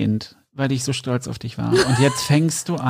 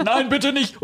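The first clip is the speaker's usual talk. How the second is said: mock plea; 12 semitones higher, slower than usual.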